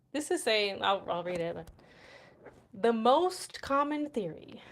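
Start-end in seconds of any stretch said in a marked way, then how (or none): 2.7-4.6 slow, grand documentary narrator; dramatic reading voice. Shown as a demonstration. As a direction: read it like a very dramatic nerd. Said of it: "feel like I need to sound like a nerd".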